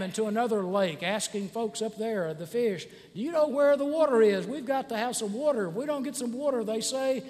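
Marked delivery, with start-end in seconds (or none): 3.1-6.6 mock-frantic, higher-pitched questioning; urgent pleading. Little fish asking other fish for water, urgent. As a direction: plead for help in a raised voice.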